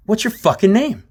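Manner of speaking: high-pitched confrontational question; retold angry demand.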